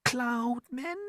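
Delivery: high, soft, rising question; hushed, high-pitched alarm; frightened murmur. A narrator's reading. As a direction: echo it in a small, high voice. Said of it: "they murmured, huddling closer together for comfort".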